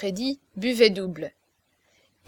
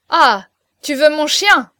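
loud, higher, slow menacing roar; 5 semitones higher, 10 dB louder than usual. A narrator's reading. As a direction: bellow the threat slowly. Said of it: "rugit la baleine".

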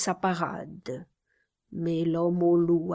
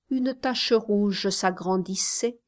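ordinary delivery, nothing marked

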